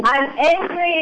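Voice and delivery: high, slow, drawn-out cry; attempted shout. Shown as a demonstration. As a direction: stretch it high as if calling out. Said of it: "Even louder than that".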